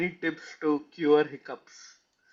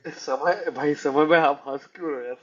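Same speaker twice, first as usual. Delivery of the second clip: ordinary talk, nothing marked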